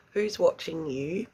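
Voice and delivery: ordinary talk, nothing marked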